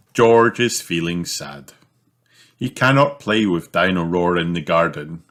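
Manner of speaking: usual speaking voice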